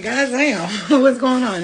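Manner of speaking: high loud mock god damn; shrill flustered outburst, laughing; retold exasperation. Retelling dropping a twenty, mock exasperated outburst. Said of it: "I was like".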